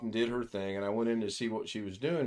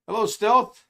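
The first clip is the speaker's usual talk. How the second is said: loud greeting; 10 semitones higher, 8 dB louder than usual. Real, not acted. Greeting a newcomer loudly.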